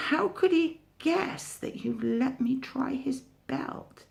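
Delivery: high whining tiger voice; high, wheedling tiger whine. Acted. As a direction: plead like a sulky child. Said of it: "whined Tiger".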